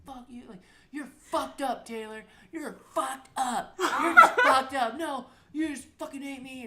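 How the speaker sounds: high, drawn-out mimicked yelling; mock screaming. Mother and son screaming in the car, hammed-up anger. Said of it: "Screaming at each other".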